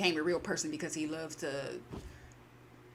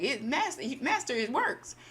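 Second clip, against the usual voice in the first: excited servant; 7 semitones higher than usual. Retold or imitated. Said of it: "he's just like".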